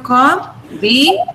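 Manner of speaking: combination divided into four separate beats; com, bi, nay, shun, each on its own; syllable by syllable. Shown as a demonstration. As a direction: pause between syllables of combination. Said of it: "divide the word into syllables".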